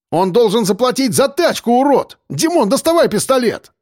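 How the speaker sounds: high-pitched irate ranting; rant, then command sharply; angry order. A narrator's reading. Driver ordering his friend to get the pistol, angry. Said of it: "велел Белобрысый".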